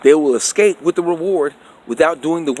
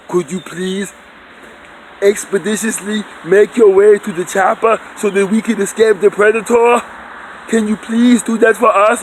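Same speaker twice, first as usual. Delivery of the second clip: mock-courteous, higher request; overly polite Arnold; 6 semitones higher, 3 dB louder than usual. Arnold politely asking people to reach the chopper, mock courteous. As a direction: sweetly overdo the politeness. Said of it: "You would like him to say".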